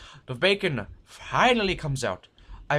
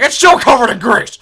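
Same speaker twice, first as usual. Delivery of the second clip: higher, much louder outraged complaint; indignant shout; 9 semitones higher, 16 dB louder than usual. Retold or imitated. Old man tossing bacon in the air, shouting his complaint. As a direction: yell it like the fussy old customer.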